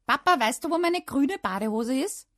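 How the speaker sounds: high, loud calling question; shouted. A narrator's reading. Child calling dad to find the swimming trunks, shouted. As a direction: yell for dad from another room. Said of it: "schrie ich".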